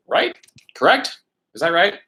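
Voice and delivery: each tag question lifted high at the end. Shown as a demonstration. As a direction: check for agreement, rising sharply on "Right?" and "Correct?". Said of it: "like a way of saying".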